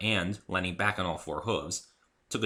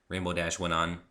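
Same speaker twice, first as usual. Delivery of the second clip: ordinary delivery, nothing marked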